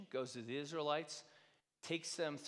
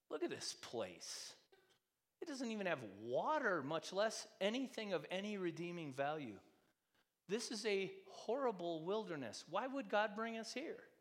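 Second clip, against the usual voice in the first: complaining; 6 semitones higher than usual. Retold or imitated. Voicing complaining Israelites in wilderness.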